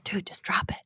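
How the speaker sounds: quiet, whispered mock warning. Telling teasing co-host to quit, hushed. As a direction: drop it to a near whisper, as if warning a friend. Retelling her reply to teasing.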